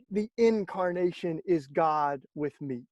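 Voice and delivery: ordinary talk, nothing marked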